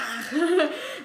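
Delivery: a proud little giggle; short laugh. Real, not acted. Slytherin fan showing off her scarf, proud giggle. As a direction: giggle with pride.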